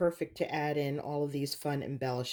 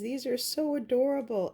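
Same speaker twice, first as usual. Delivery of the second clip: much higher, delighted gush over the bees; bright cooing delight at cute little bees; 7 semitones higher than usual. Real, not acted. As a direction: squeal it out like you just found treasure. Crafter gushing over tiny bees.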